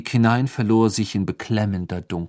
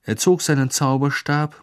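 usual speaking voice

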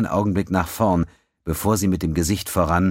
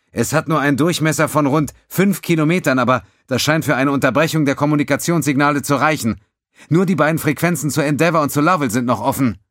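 higher, louder eager technician; young officer voice; 9 semitones higher, 5 dB louder than usual. A narrator's reading. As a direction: report it briskly and keen. Alcalet voiced reporting.

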